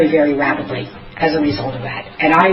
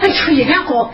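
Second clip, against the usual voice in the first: disbelief; 8 semitones higher than usual. Retold or imitated. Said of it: "I was like".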